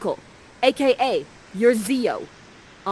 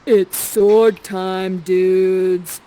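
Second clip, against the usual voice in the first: slow and much louder, a hammed-up arena call; big announcement; 10 dB louder, much slower than usual. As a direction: drag out sword time to hype the kids. Announcing the sword fight.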